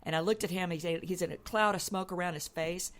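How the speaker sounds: normal talking voice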